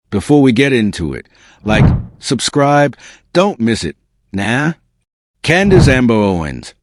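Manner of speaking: ordinary delivery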